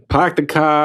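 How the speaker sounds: put-on light Boston accent, dropped r's. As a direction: pahk the cah, Boston style. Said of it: "I can't do Boston accent".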